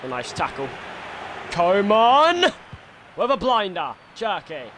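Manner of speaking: live football commentator voice; excited stadium commentary; play-by-play commentary. Acted. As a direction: call the match like a TV pundit.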